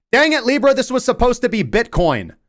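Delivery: high, loud mock outburst; mock exasperation. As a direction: blurt it out in hammed-up frustration. Blurts exasperation at Libra.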